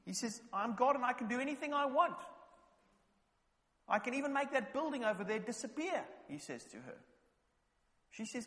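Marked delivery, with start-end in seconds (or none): 0.5-6.0 put-on high, louder childish boast; boastful boy. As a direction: brag like a cocky little boy.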